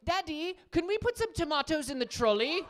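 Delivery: put-on high little-boy British accent. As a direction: imitate a polite British cartoon child. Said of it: "we're raising him British".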